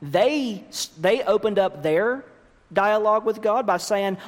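ordinary talk, nothing marked

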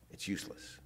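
a sudden hushed whisper; whispered verdict. Real, not acted. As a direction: drop it to a whisper, letting it sink in. Preacher drops voice on verdict.

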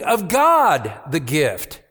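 slow emphatic reading of the verse; emphatic gift. Shown as a demonstration. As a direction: lean on it slowly. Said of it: "Paul makes an emphasis".

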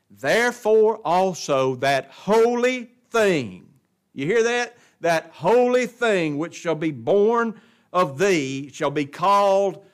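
slow, loud, drawn-out scripture quote; forceful preaching. Real, not acted. Preacher quoting the angels' words to Mary, forceful preaching. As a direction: ring out each word of the verse slowly.

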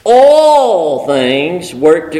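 "All" stretched and weighted; slow, with "All" pushed out front; stressing that nothing is left out. Shown as a demonstration. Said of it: "I really need to put the emphasis on all".

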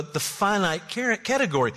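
usual speaking voice

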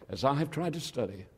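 usual speaking voice

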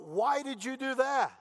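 mock yell. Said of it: "yells out".

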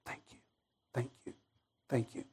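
barely audible, low repeated thanks; whispered-soft thanks. Retold or imitated. Spared passenger thanking God, hushed gratitude. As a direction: breathe out each thanks separately, humbled. Acted prayer of stunned gratitude.

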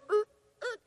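put-on high little baby whimper. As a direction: make a tiny fussing sound.

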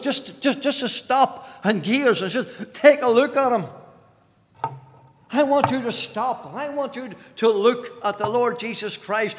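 high, urgent pulpit appeal; impassioned preaching. Real, not acted. Preacher urging the unconverted to look at Christ, impassioned. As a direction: plead with them to stop and look.

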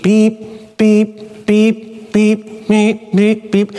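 mock car alarm beeps; imitated car beep. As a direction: mimic the seatbelt warning chime. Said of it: "there's this beep".

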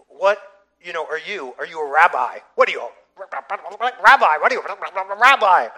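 loud, building angry rant; yelling uncle. Retold or imitated. Uncle in his nephew's face in the elevator, yelling. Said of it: "he's, he's yelling out with foul language".

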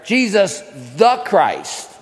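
slow, deliberate "Jesus the Christ", leaning on "the"; stress on THE. Shown as a demonstration. Preacher inserting the definite article, slow with "the" emphasized. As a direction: make "the" stand out before Christ. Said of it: "we should insert the definite article there".

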